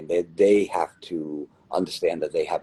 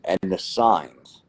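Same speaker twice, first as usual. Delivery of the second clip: slow warning; 3 semitones higher, slower than usual. Real, not acted.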